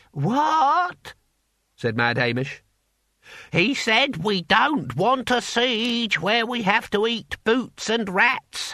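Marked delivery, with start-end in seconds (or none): 0.1-1.2 put-on high, drawn-out old man's "What?"; a deaf old codger straining to hear. Deaf old Hamish mishears. 3.5-8.7 speaking up. Acted. Repeating loudly for deaf Hamish.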